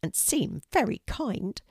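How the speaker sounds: high, gentle bird voice; lightly voiced friendly pigeon. A narrator's reading.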